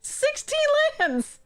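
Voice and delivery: incredulous high-pitched rant; exasperated outburst. Real, not acted. Mana-flooded, yelling at the deck.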